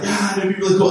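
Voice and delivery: high, excited imitation of an amateur cameraman; imitating a thrilled man on video; excited video guy. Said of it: "he's like".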